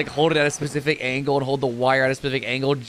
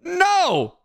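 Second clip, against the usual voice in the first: a sharp, much higher no; outraged no. Real, not acted. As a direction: cut them off with a high outraged no. Streamer refusing entitled chat.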